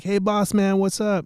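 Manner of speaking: high, loud put-on greeting; hailing the boss. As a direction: imitate a worker calling out to distract the boss. Acting guy hailing his boss.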